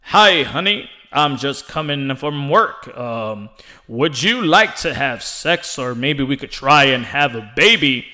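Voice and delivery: low, slow mock announcer voice; Ray Flores. Announcer asking his wife for some love, announcer voice. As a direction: woo your wife like a booming announcer. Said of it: "imagine Ray Flores asking his wife".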